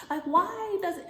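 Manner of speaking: high laugh through the words. Real, not acted. Cracking up at her own bonnet joke, laughing. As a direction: crack up mid-question.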